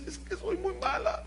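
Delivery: tiny, high, soft whimper; imitated crying child. Daughter in bed answering her mother, softly tearful. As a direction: whimper it like a little girl. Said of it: "En la noche lloraba".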